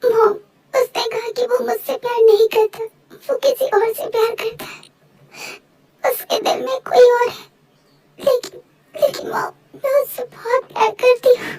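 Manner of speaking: high, sobbing heartbreak; acted crying. Heartbroken daughter tells her mother he loves someone else, crying. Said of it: "पहले तुम रोना बंद करो".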